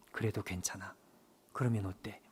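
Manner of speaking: low, hushed and tender reassurance; gentle voice of God. Acted. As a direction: soothe him softly as God would. God's gentle reply, very soft. (낮고 부드럽게 달래는 하나님의 음성)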